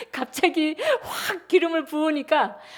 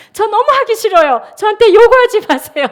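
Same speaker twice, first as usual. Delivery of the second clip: high, loud defiant plea; defiant protest; 10 semitones higher, 9 dB louder than usual. Retold or imitated. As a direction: protest to God loudly. Quoting her defiant prayer.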